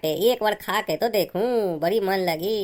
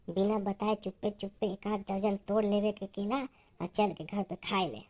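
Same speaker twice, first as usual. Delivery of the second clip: hushed, much softer conspiring; furtive plotting; 8 dB quieter than usual. Acted. Secretly plotting to steal bananas.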